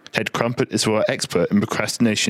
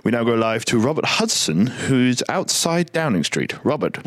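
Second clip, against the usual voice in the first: usual speaking voice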